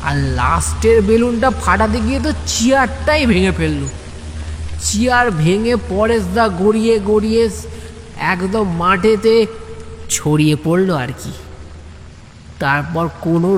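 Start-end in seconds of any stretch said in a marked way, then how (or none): none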